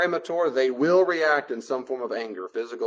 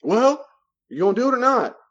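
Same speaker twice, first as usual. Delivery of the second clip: high, loud, aggrieved complaint; whiny boundary buster; 11 semitones higher, 7 dB louder than usual. Acted. Boundary buster complaining when told no, high and loud. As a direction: sound wronged and entitled.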